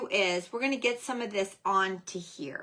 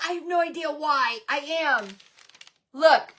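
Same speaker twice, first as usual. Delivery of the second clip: ordinary talk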